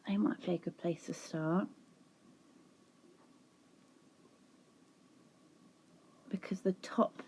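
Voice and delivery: whispering to herself while deciding; whispered musing. Real, not acted. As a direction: think it through under the breath. Thinking aloud to herself.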